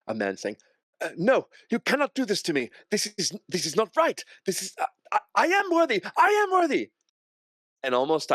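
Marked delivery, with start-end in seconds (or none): 1.0-6.9 high, frantic pleading that builds to a shout; panicked condemned man, voice pushed way up; desperate protest. Acted. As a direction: plead for your life, pitch climbing to a loud wail. Condemned man pleads as he's carried.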